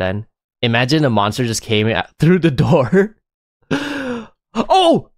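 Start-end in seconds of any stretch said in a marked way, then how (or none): 3.7-5.1 sharp gasp into a high "oh"; jump-scare yelp, pitched way up; real startled cry. Real, not acted. Monster hits streamer in horror game.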